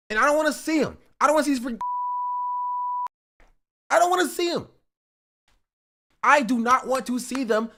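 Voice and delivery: loud, high-pitched hammed-up indignation; mock-outraged rant. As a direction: rant at full volume in exaggerated exasperation. Mock-outraged rant about Canadians.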